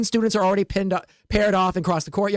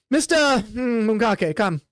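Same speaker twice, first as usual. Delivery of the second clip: slow stern summons; slower than usual. Acted. Instructor summons the player.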